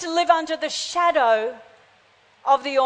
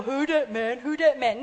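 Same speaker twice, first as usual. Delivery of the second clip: lower, softer, teasing put-on voice; mocking voice; 4 semitones lower, 5 dB quieter than usual. A mocking father teasing his frightened child, in a lower put-on voice. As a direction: laugh at a scared kid in a silly mock voice. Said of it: "Daddy's not gonna laugh at you and go".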